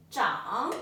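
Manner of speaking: "Zhang" said clearly, rising at the end; teacher's model. Shown as a demonstration. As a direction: say "Zhang" slowly for the student to repeat. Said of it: "So let's review".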